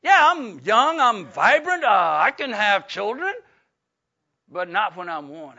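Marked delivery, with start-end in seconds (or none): none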